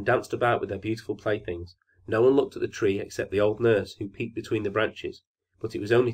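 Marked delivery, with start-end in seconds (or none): none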